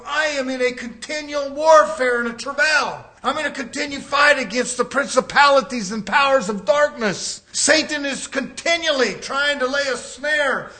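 loud, forceful testimony; preaching at full force. Real, not acted. Preacher telling the congregation of his warfare, full-force preaching. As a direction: declare the fight with full voice.

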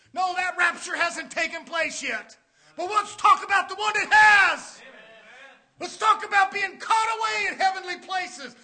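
impassioned sermon delivery; forceful preaching. Real, not acted. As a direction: urge the congregation on with a ringing cry.

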